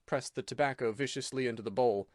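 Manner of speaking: ordinary delivery, nothing marked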